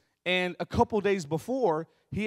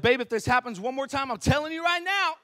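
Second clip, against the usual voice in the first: worked-up warning; 5 semitones higher than usual. Retold or imitated. Husband warning wife about the banging, rising mock threat. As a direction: pitch climbing into mock fury. Warns wife, working himself up.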